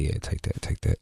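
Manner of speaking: breathy whisper, unvoiced; whispered porn narration. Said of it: "narrate... they say what they're thinking".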